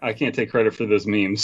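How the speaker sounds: ordinary talk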